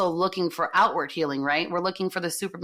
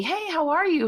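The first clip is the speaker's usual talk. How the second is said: sweet friendly greeting; 11 semitones higher than usual. Retold or imitated. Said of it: "she just saying to me".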